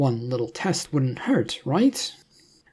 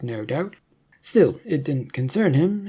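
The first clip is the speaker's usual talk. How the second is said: ordinary delivery, nothing marked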